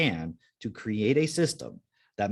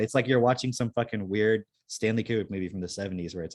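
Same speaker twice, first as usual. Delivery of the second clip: usual speaking voice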